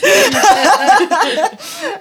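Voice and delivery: loud, high burst of laughter; big laugh. Real, not acted. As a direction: laugh hard at your own confession. Laughs at own problems.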